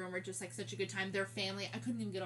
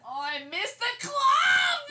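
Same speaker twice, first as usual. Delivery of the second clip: high, slow wailing shout; excited shout; 12 dB louder, much slower than usual. Retold or imitated. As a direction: belt it out like a party cry. Missing clubs while dancing.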